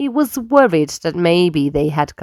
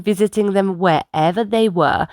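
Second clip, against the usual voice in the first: ordinary delivery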